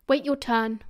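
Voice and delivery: plain reading voice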